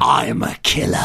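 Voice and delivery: a Cockney villain shouting his guilt. Shown as a demonstration. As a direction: bark it like a proud villain in Cockney. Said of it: "my best Cockney accent".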